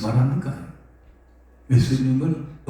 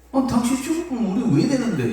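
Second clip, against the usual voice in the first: Peter's plea; 8 semitones higher than usual. Retold or imitated. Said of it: "이 말이야".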